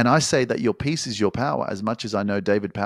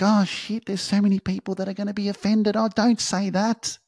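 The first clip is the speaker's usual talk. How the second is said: much higher, nervous fretting voice, slowing at the end; mock timid self; 12 semitones higher than usual. Coach mocking a timid version of himself afraid to offend. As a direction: play a scared people-pleaser. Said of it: "Because I'd be like".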